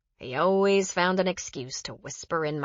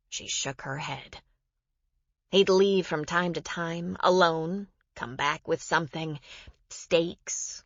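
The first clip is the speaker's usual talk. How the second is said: plain reading voice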